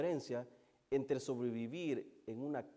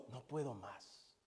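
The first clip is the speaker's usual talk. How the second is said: low, slow and hushed; quiet, spent admission of despair; defeated confession; 4 semitones lower, 7 dB quieter, slower than usual. Acted. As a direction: drop suddenly to a worn-down murmur. Voices a despairing believer's words.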